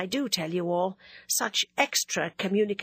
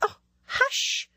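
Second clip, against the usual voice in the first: 1 shocked hush. Acted. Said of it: "putting her fingers in her ears".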